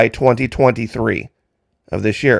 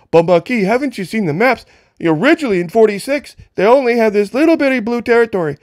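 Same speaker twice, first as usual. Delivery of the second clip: mock critic voice pitched much higher, quick at the end; mocking imitation; 8 semitones higher than usual. Mimicking folks who object about the maps, in a high mocking voice. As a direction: sneer it back like a heckler quoting maps. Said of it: "a lot of folks are gonna say".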